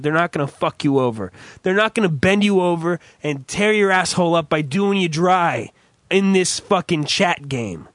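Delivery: ordinary talk, nothing marked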